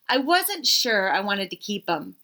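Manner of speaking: ordinary delivery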